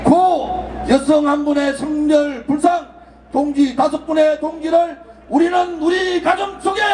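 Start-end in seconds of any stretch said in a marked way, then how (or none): 0.0-3.8 slow, drawn-out call of names; solemn roll call. Real, not acted. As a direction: call each name slowly for the crowd to repeat. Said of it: "제가 선창할 테니까 따라해 주시기 바랍니다". (느리게 부르는 추모 호명)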